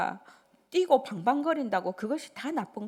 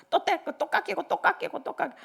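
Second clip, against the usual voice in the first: higher, repeated grumbling about cuts; mock grumble; 6 semitones higher than usual. Pastor griping about pension cuts, whining. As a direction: whine about the cuts, building.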